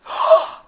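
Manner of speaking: put-on little girl's amazed gasp; sharp, acted intake of breath; imitated gasp. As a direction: gasp as a stunned child would. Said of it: "She went".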